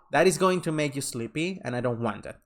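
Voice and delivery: ordinary delivery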